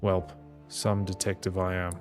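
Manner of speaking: usual speaking voice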